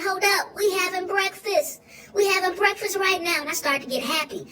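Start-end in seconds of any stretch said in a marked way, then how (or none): 0.0-3.4 high, eager burst over the bacon; high excited "we having breakfast". Retold or imitated. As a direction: rise up thrilled at breakfast. Bacon arrives, gets excited.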